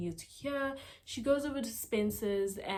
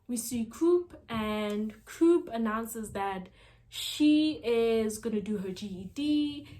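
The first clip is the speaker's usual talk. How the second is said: ordinary talk, nothing marked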